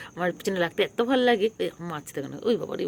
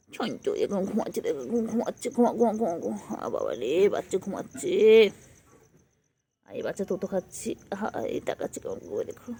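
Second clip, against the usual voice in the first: soft hush, near a whisper; 6 dB quieter than usual. Real, not acted. Hushed words over the sleeping kitten.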